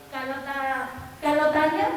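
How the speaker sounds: normal talking voice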